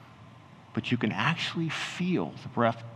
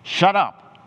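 much louder, higher yell; acted angry shout; 8 semitones higher, 10 dB louder than usual. Crowd silencing the blind men, loud angry shout. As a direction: shout them down, harder. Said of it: "get down and say".

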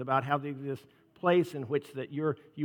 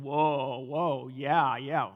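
mock excited whoa; 4 dB louder, much slower than usual. Shown as a demonstration. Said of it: "you start going".

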